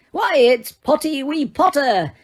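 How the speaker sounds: high, slow gleeful taunt; sing-song mockery from a prankster ghost; cackling poltergeist. A narrator's reading. Poltergeist taunting Harry in the corridor, high cackling. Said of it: "cackled Peeves".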